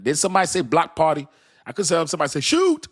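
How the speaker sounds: excited. Real, not acted. Excited joke about block party.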